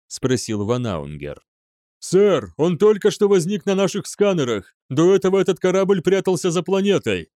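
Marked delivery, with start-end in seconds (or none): 2.0-7.3 high, urgent junior officer; alarmed report. A narrator's reading. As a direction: report breathlessly urgent news upward. (встревоженно докладывает)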